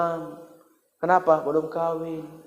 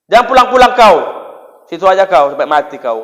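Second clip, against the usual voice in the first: angry parent; 2 semitones higher, 7 dB louder, faster than usual. Acted. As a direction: yell at the son to stay away for good. Angry parent shouts at son. (bentakan orang tua yang marah)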